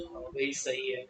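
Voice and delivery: low, soft student reply, in a changed timbre; a hint of a student agreeing offhand; voicing students. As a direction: answer like a bored student, quietly. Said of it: "N apple".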